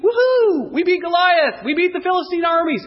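jubilant high, loud whoop; excited cheering. Acted. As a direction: crowd victory cheer, shouted high and loud. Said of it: "They're just excited. They're jumping around going".

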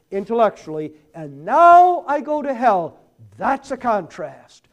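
loud, high preacher's cry; impassioned preaching. Real, not acted. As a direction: thunder the warning at the congregation. Preacher climaxes on warning.

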